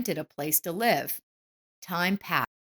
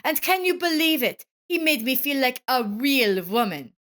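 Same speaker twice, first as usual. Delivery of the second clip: higher, louder gloating; taunting shout; 7 semitones higher, 5 dB louder than usual. A narrator's reading. Wife taunts husband about lover, higher and louder. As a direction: rub it in with a raised voice. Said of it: "shouted Evelyn".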